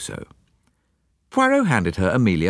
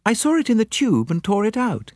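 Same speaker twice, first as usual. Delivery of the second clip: Miss Lemon voice; 11 semitones higher than usual. A narrator's reading. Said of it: "Miss Lemon produced a newspaper cutting".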